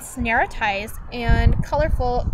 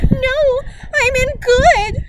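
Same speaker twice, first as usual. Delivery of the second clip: high desperate shout; 13 semitones higher, 6 dB louder, slower than usual. A narrator's reading. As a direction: cry it out high and loud. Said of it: "she shouted".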